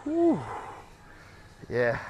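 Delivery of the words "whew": high breathy whew; high exhale. Real, not acted. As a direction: blow out hard after effort. Exhale after set.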